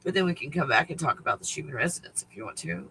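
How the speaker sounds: ordinary talk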